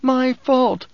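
high, pained outburst of guilt; anguished self-blame; groaned. A narrator's reading. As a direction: groan it out, pitched right up. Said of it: "Alia groaned".

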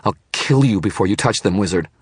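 high, loud protective snarl. A narrator's reading. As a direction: snarl like a mother defending her own. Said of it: "she snarled".

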